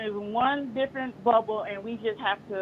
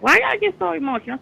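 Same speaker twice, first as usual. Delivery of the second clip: quoting her son; 6 semitones higher, 9 dB louder than usual. Retold or imitated. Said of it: "he asked me that".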